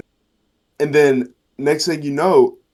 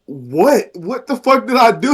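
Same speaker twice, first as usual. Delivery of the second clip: mock indignant surprise; 10 semitones higher, 4 dB louder than usual. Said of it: "And I was like".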